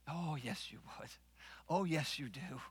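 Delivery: low, very soft knowing reply; soft, pointed aside; hushed rebuttal. Acted. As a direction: drop the voice to contradict them quietly. Preacher answers the imagined denial.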